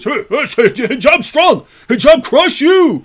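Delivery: mock caveman bragging; mock fanboy boast. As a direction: brag like a meathead fan. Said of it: "in defense of your religion is".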